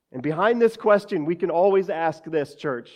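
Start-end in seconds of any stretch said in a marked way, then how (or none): none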